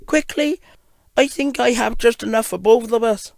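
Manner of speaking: high little engine, urging; high, urgent storybook engine; gold engine. Acted. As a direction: urge him on in a high little voice. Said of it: "the gold engine told him".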